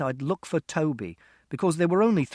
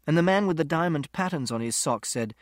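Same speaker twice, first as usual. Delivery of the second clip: plain reading voice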